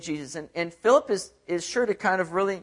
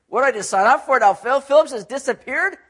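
higher and louder, alarmed cry; mock-panicked eunuch losing it; panicked outburst; 6 semitones higher, 6 dB louder than usual. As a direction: blurt it out in rising panic. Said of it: "kind of freaking out".

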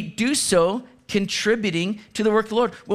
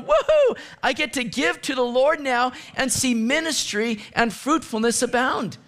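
woohoo soaring much higher, then bright and eager; joyful whoop; 5 semitones higher than usual. Retold or imitated. Pastor acts out a giver skipping into church, gleeful. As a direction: skip in cheering like a happy kid. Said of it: "skip into church, going".

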